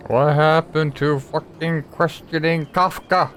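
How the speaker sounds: usual speaking voice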